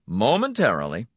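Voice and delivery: usual speaking voice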